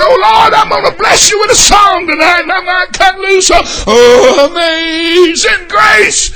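loud, high, stretched-out singing call; mock hymn burst. Preacher acting out singing a hymn in church, loudly. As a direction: belt it out for the Lord.

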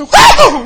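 high loud woo; shouted praise; joyful whoop. Retold or imitated. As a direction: whoop like the spirit hit. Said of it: "I got cut loose".

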